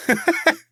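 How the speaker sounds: high, sharp mocking laugh; scoffing laugh. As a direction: burst out laughing in disbelief. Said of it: "Anthony scoffed".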